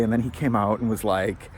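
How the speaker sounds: ordinary delivery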